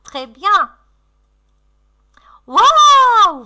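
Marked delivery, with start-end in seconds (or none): 2.5-3.5 high, loud, drawn-out wow; gasp-free, big wow for children; feigned amazement. Pretend. Teacher admiring the pupils' catch, amazed.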